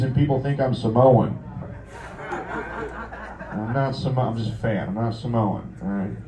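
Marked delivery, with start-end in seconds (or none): none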